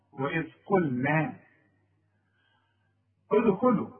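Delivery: قلنا with the noon kept light and thin. Shown as a demonstration. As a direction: correct recitation. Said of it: "رقق النون".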